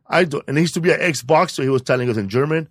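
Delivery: ordinary talk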